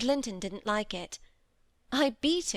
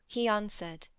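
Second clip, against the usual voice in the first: usual speaking voice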